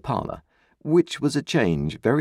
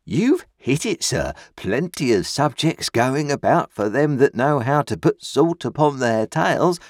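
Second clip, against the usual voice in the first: pompous uncle; 5 semitones higher, 3 dB louder than usual. A narrator's reading. Pumblechook holding forth at dinner, high, loud, pompous. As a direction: hold forth grandly on pork. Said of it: "said Uncle Pumblechook".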